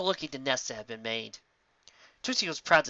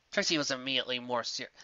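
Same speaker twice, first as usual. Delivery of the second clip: plain reading voice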